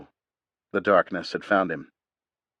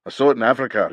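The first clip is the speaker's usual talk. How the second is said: louder and higher, bitter; bitter outburst; 5 semitones higher, 5 dB louder, much faster than usual. A narrator's reading. Old warrior snapping at Reese, louder and higher. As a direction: flare up at Reese.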